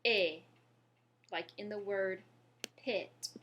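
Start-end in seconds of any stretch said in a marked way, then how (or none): 0.0-0.4 "eh" loud and isolated, as in pet; open è sound. Shown as a demonstration. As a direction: demonstrate "eh" as a strong open vowel. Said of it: "the sound that it's gonna make".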